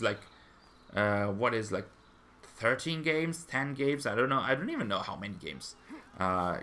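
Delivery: ordinary delivery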